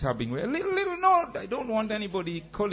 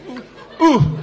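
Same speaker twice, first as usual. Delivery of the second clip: high whoop; 9 semitones higher, 11 dB louder than usual. Shown as a demonstration. Exercise whoop.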